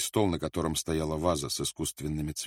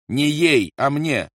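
irritated snap; 8 semitones higher, 9 dB louder, slower than usual. A narrator's reading. Rolf snaps at Barbara.

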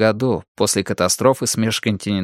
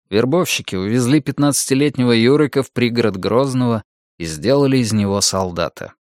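ordinary delivery, nothing marked

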